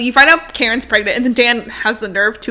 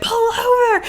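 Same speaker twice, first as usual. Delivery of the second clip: high mock queasy plea; 11 semitones higher than usual. Rachel sick in the limo.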